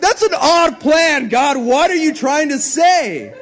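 a prophet laughing at God's odd plan; incredulous prophet. Retold or imitated. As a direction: question God in mock disbelief. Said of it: "You're like".

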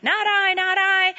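high sing-song chorus of refusals; storyteller's high animal voices saying no; animals refusing. A narrator's reading. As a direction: chant it up high like barnyard animals.

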